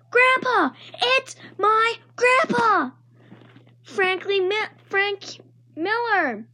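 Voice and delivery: very high, delighted squeal; excited shout. Acted. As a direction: call out happily to grandpa. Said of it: "I shouted".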